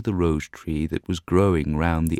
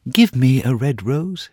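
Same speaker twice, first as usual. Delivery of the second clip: raised pleading bird voice; nightingale crying out; 8 semitones higher, 7 dB louder than usual. Acted. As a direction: beg the rose tree. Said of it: "she cried".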